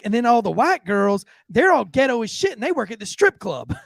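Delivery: high-pitched Southern waitress; Southern accent. Retold or imitated. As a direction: tell it emphatically in a Southern voice. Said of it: "real Southern accent".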